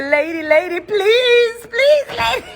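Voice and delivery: high, sobbing plea; crying big man. Retold or imitated. Retelling the big man crying to the gate lady, high sobbing plea. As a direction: whimper it like a grown man begging. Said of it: "the big man I go come and say".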